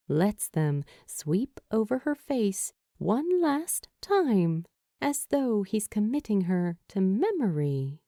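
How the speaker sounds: usual speaking voice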